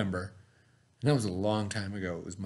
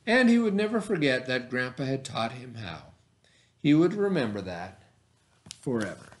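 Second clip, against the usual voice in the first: put-on reading a picture book aloud to children; story reading. A narrator's reading. Teacher reading a bike story to her class, storytelling voice. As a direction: tell it gently, like story time.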